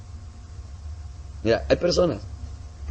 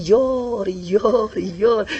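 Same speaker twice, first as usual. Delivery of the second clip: mock weeping; 9 semitones higher, 3 dB louder, much slower than usual. Man weeping before the pastor.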